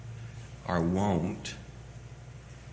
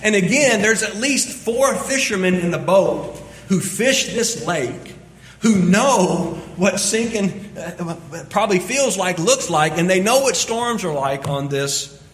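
loud, fast-building sermon delivery; preaching at full force; 9 semitones higher, 8 dB louder than usual. Acted. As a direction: drive the point home to the congregation. Preaching with rising intensity.

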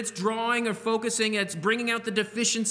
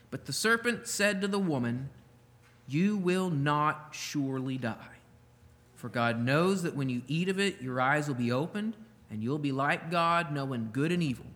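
scripture reading; 5 semitones lower than usual. Acted. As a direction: read the verse with weight. Reading Genesis verse four.

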